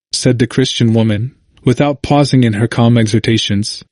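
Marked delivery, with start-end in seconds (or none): none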